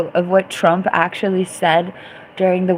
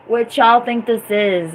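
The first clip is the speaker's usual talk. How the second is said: ordinary delivery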